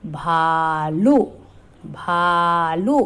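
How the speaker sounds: very slow, भालू with the ऊ stretched long; long drawn ऊ. Shown as a demonstration. Teacher showing the long vowel to children, slow. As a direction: draw out the ऊ in भालू. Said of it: "बड़े ऊ की मात्रा".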